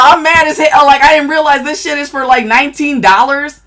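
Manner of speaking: loud, high, outraged rant about the price; real anger. Real, not acted. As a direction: vent loudly, genuinely fed up at overpaying. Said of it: "I'm mad, I'm sorry".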